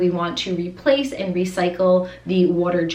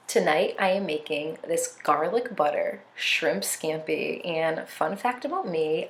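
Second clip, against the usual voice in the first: soft voiceover; 5 dB quieter than usual. Acted. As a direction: ease into a calm voiceover. Soft voiceover for cooking segment.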